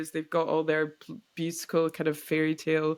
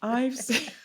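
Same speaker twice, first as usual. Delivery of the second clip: real giggle cutting off the words; helpless laugh taking over mid-sentence; breaking into laughter; 4 semitones higher than usual. Real, not acted. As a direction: start the phrase, then crack up. Breaks into giggles, then says sorry.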